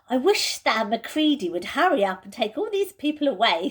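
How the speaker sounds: Susan's voice. Acted. Susan, cramped in the wardrobe, complains impatiently. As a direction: voice a fidgety girl stuck in a wardrobe.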